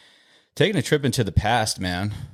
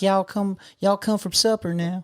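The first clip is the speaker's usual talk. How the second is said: high-pitched put-on Tennessee twang; Tennessee accent; 11 semitones higher than usual. Man mimicking his cousins from Tennessee, exaggerated country accent. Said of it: "Just how they talk".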